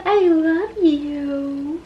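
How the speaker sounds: very slow, soft loving murmur; drawn-out and soft, melting over the cat; slow, soft pet-owner murmur; tender to cat. Real, not acted. Tenderly talking to her cat.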